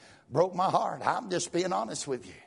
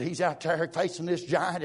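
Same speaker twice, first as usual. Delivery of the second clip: normal talking voice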